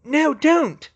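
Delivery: high, loud cry of alarm; urgent high-pitched yell. A narrator's reading. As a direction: yell to stop them. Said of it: "she yelled".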